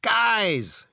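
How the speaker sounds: high-pitched call for attention; mild yell. A narrator's reading. Frank interrupts the arguing robots with a mild yell. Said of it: "yells Frank".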